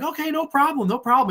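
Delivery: high-pitched, placating imitation; mock compliance. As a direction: act out agreeing a little too readily. Voicing car passengers humouring toll man.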